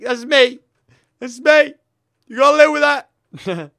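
high, loud declaration; mock-proud outburst. Player owns up to his constant mistakes, loud outburst. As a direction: blurt it out loudly as a jokey boast.

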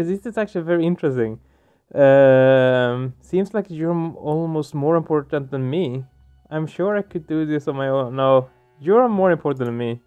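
ordinary talk

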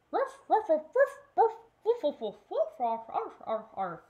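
loud barking dying down; very loud dog barks. Acted. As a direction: bark like a puppy heading off home. Puppy barking going home.

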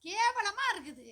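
high, loud, drawn-out disgust; disgusted outburst. Real, not acted. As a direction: stretch it out loudly in dismay. Wife disgusted by shaved moustache. (உரக்க, இழுத்து வெறுப்புடன் சொல்வது)

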